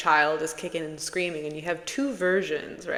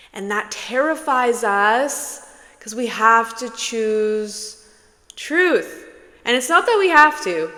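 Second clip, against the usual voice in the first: rising intensity; 4 semitones higher, 9 dB louder than usual. Real, not acted. Impassioned build to truth.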